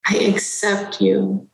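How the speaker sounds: ordinary talk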